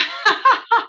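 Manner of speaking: high, loud tearful laugh; emotional laugh. Real, not acted. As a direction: laugh through welling tears. Moved actor laughs emotionally.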